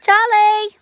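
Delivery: loud high call across the yard. Real, not acted. Calling out for chicken.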